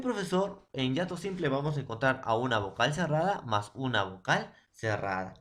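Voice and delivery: ordinary delivery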